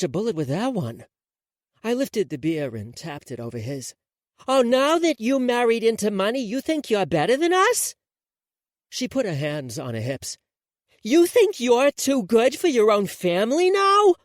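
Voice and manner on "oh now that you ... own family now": higher, louder indignant accusation; loud affronted jab at her sister. Acted. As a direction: call her out, offended. Rory confronts Ivy, hands on hips.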